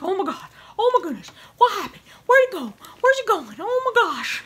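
high-pitched frantic pet voice; excited cat. Acted. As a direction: voice the cat's giddy panic. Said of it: "he's so excited now. He's like".

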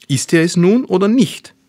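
loud, high-pitched demand; called out. A narrator's reading. Middle brother demands who the man is, calling out loudly. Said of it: "rief der Mittlere".

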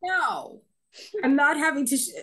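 mock-stubborn no, laughing through it; laughing refusal. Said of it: "I'm like".

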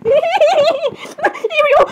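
high, squealed name through laughter; helpless giggles breaking into the word; laughing. Real, not acted. Apologizing to Ariel after the glitch, cracking up. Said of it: "I'm so sorry for laughing like that".

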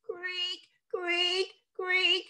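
high, soft creaky rope noise; creaking rope. A narrator's reading. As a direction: mimic an old rope creaking.

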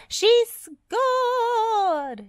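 high, long goal celebration; triumphant high shout. A narrator's reading. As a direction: holler it like a commentator calling a goal. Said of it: "shouted the little cement mixer".

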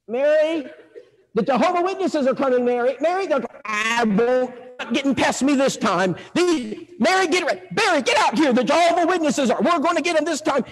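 high, urgent character voice; hammed-up excited alarm; agitated husband. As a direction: call the wife to battle stations. Husband alerts wife at window.

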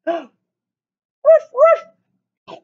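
high, loud yappy woof; dog barking. Acted. Reading to children, teacher barks as the dog, high and loud. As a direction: bark like a little dog.

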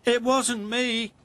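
high, plaintive denial; protesting. A narrator's reading. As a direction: insist on innocence in a high character voice. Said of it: "protested Ned".

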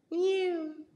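high mewing cat. A narrator's reading. Cat interrupting the mice with a high mew. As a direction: mew like a small cat at the door. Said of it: "interrupted Simpkin".

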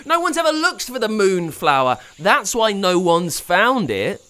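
high, loud frustrated shout; angry boy shouting; shouting at the screen. A narrator's reading. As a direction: yell at the TV. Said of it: "Pablo screamed at the screen".

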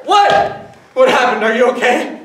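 high, loud alarmed questions. Acted. Husband alarmed by his wife's sudden cry, high and loud. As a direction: jump in worried and loud.

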